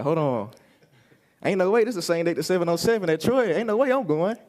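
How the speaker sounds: rising to a high incredulous cry; can't believe the dates clash; shocked disbelief. Retold or imitated. Shocked at camp date clash.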